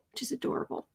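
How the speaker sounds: an unvoiced, fond whisper; whispered fond aside. Real, not acted. Host fondly telling chat the cat's nickname, whispered. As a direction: breathe it out like a doting secret.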